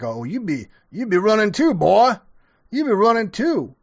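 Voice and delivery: lightly put-on street voice, rising on too; hammed-up imitation of teasing schoolkids; mock cool guys. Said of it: "they're like".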